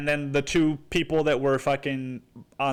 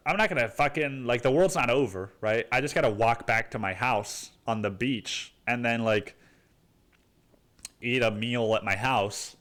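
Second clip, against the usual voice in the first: ordinary talk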